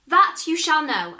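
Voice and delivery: high, loud sure promise; confident promise. Acted. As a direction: declare it with full confidence.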